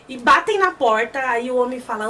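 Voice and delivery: ordinary talk, nothing marked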